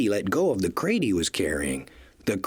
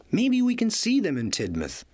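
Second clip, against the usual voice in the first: hopeful; 10 semitones higher than usual. A narrator's reading. Thomas hopeful.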